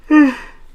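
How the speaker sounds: big, high, loud sigh out of nowhere. Real, not acted. Streamer reading member list, sudden loud weary sigh. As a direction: heave a loud sigh, then catch yourself.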